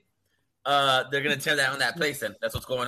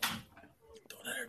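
whispered aside; 20 dB quieter, much slower than usual. Real, not acted. Hushed aside to child.

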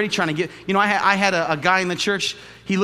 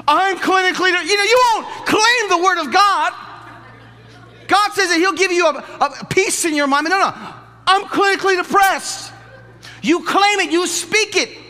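high, loud, laughing preacher; preaching at full force; 8 dB louder than usual. Acted. Pastor mocking those who claim illness, loud laughing preaching. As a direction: shout it with a laugh at the crowd. Shouting sermon climax.

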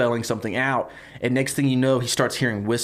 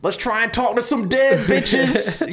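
put-on cocky teenage boy; 11 semitones higher, 4 dB louder, slower than usual. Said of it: "teenage boys, they're like".

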